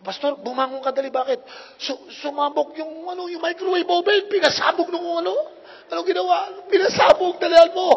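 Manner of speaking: high, loud panicked yell; hammed-up frantic call about the exploding microwave; panicked shouting. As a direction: yell for help as the kitchen burns. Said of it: "sumisigaw yung miss ko".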